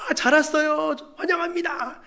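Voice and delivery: high-pitched greeter voice; acted, high welcoming call; mock welcome. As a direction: play an effusive welcomer at the door. Acts out a welcome in heaven.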